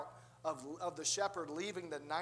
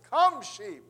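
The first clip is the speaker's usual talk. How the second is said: loud, raised holler to the flock; shepherd's call; 4 semitones higher, 13 dB louder than usual. Retold or imitated. Pastor showing them how it's done, hollered call. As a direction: call the sheep in with authority.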